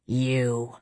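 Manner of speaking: low-key snarl, softly spoken; a quiet, restrained snarl at a foe. A narrator's reading. Cassian confronting the descending warrior, snarling quietly. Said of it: "Cassian took a step toward the descending warrior and snarled".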